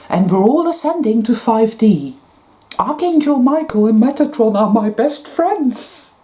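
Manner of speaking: high, sing-song parody of a smug believer; mock new age believer. Ridiculing light workers who boast of archangel friends, sing-song and high. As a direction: lay on the fake serenity.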